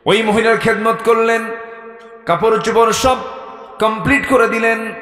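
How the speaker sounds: high, loud phrases with pauses between; full-force preaching. Real, not acted.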